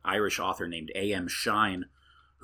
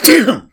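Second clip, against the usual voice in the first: loud high burst of a sneeze; sneezing; 19 dB louder than usual. Real, not acted. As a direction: let a big sneeze explode out. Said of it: "sneezed on this one".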